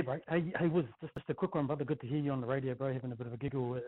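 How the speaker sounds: ordinary talk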